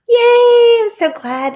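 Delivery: gasp, then a high, loud, slow yay; overjoyed by a compliment; gasping delight. Real, not acted. Viewer praises her podcast.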